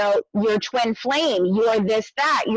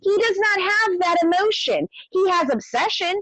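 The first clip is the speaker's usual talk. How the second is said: emphatic; 9 semitones higher than usual. Real, not acted. Heated rebuttal of people's claim.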